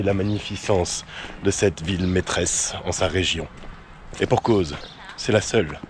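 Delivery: hushed grandiose history voiceover; documentary narration. Acted.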